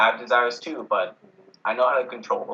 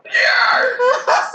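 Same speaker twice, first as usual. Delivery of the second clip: laughing shout; 7 dB louder than usual. Real, not acted. Laughing at the eagle joke.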